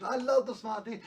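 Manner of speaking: put-on high, eager little girl voice, lightly done; dad playfully imitating his young daughter's excitement; excited child imitation. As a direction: squeal it like an excited kid. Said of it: "She said".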